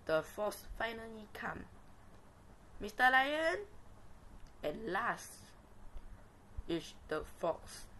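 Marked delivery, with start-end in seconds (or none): 2.8-3.7 loud, high call into a cave. Acted. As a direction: call out from outside. Fox calls into den.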